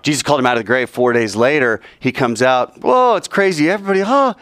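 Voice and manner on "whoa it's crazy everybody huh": high-pitched mock astonishment; amazed crowd. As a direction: imitate a stunned, gasping crowd. Crowd amazed as Lazarus comes out.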